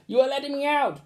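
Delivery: put-on high child's voice; relieved boy. Roland to the teacher, high and relieved. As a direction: sound glad to be free.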